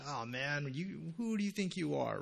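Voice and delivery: lightly voiced sneering mocker; a hint of a mocking thief on the cross; mocking criminal. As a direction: scoff at him like a jeering criminal. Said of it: "is mocking them".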